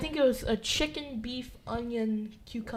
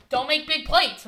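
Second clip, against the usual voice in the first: louder, higher repeat of a warning; retold scolding, pushed louder; firm retold order; 5 semitones higher, 6 dB louder than usual. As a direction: imitate someone insisting with raised voice. Retold order repeated more firmly.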